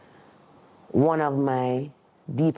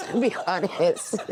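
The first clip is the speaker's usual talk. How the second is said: laughing; 7 semitones higher than usual. Real, not acted. Laughs at calling herself weird.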